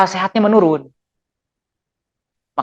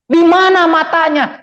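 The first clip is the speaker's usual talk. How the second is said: mock angry; 8 semitones higher, slower than usual. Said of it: "Ada orang marah".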